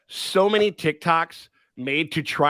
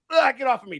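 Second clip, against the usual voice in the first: higher-pitched imitated shove-off; mock disgusted; 9 semitones higher than usual. Podcaster acts fighter shaking off an arm, mock disgust. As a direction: groan it out, hammed-up annoyance. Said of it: "he's like".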